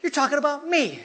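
high-pitched playful mock indignation; Wendy objecting. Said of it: "Wendy's like".

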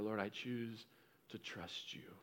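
breathy whisper of surrender; whispered prayer. Pretend. Believer quietly surrendering to the Lord in a whisper. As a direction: pray it under your breath, barely audible. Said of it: "And you go".

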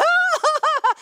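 high, loud burst of laughter; loud "ha-ha" held up high; imitated whoop of laughter. Preacher showing what she does home alone, loud high laughing. Då börjar jag liksom.

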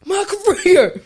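high, very loud mocking cry; exaggerated loud, high-pitched mimicry; Zeke impression. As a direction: blurt it out in a hammed-up imitation. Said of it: "Why does he talk like that?".

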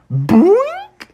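very high springy boink; cartoon boink. A narrator's reading. Froggy lands over the fence, cartoon boink. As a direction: pop out a high bouncing noise. Sound effect of the hop.

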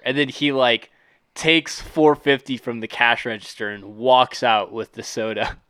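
ordinary talk, nothing marked

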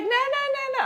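very high, rapid string of refusals; mock protest. Best friend denying she isn't ready, high-pitched mock protest.

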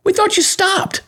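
mocking fans. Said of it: "They say".

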